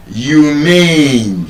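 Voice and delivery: humane with the second syllable stretched long; stress on second syllable. Shown as a demonstration. Teacher showing students an adjective's stress, second syllable drawn out in humane. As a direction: lean on the MANE of humane. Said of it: "is stressed on the second syllable".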